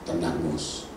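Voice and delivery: soft, low soothing voice; calm him down gently; calm reassurance. Acted. God soothing Moses, soft and low. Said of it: "Tuhan bilang dari atas".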